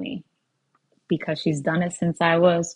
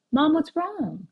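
lightly voiced small girl, a bit higher; little girl's question; 10 semitones higher than usual. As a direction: ask like a worried three-year-old, only lightly played.

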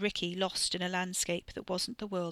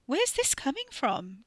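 higher, slow and insistent demand; 7 semitones higher, slower than usual. A narrator's reading. Sister demands an answer from her brother, insistently. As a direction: press him for an answer. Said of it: "Katie demanded".

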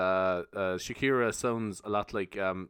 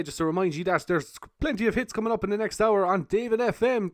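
radio voice; 7 semitones higher than usual. Pretend. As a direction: hype it like a radio DJ. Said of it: "I'd do the old radio voice anyway".

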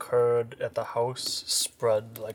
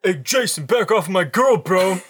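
higher, louder dudebro voice; bro voice; 9 semitones higher, 13 dB louder than usual. Acted. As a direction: puff up like a macho bro. Voicing Todd.

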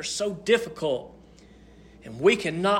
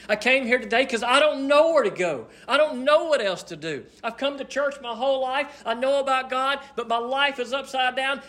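raised-pitch, insistent testimony rhythm; impassioned preaching; 8 semitones higher than usual. Real, not acted. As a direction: pile up each confession with rising urgency.

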